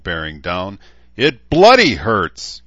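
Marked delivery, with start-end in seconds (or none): 1.2-2.6 loud, pained bellow through labour; pained cry. A narrator's reading. Woman in labour bellowing at her husband, loud and pained. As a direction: roar it out in pain, slower.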